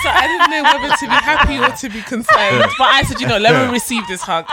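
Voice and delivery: cracking up mid-story; laughing. Real, not acted. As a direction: barely hold the laughter in. Telling funny story, cracking up.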